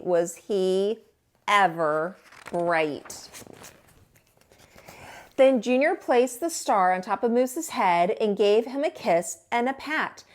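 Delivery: ordinary delivery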